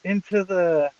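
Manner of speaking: mock commentator losing it over the action; hammed-up excited commentary call. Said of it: "he's like".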